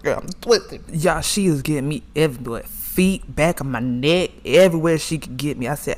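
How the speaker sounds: ordinary talk, nothing marked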